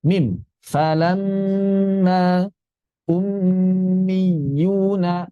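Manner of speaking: drawn-out, chanted verse recitation; slow Quran recitation. Shown as a demonstration. Teacher reciting verses as a model for students. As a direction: chant each word slowly and evenly. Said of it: "recitation demo".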